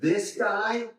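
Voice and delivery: single out "this guy" with a high, slow emphasis; heavy high-pitched emphasis on "this guy"; emphatic. Acted. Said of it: "is in emphatic position".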